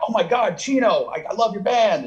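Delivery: high-pitched starstruck gushing; excited fan. Retold or imitated. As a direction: gush like a fan meeting an idol. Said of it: "they're excited".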